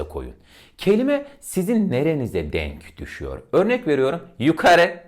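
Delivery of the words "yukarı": yukarı said very high and loud. Shown as a demonstration. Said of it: "Bakın kelime biraz yukarı anlamda".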